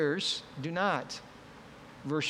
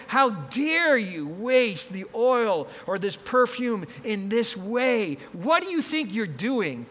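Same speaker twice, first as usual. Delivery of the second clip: slow, loud, higher scolding; 5 semitones higher, 4 dB louder, slower than usual. Acted. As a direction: berate her word by word. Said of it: "they scolded her".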